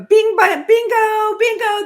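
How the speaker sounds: loud, high excited exclamation; real excitement. Real, not acted. Coach agreeing on the number one issue, excited outburst. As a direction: burst out in emphatic agreement.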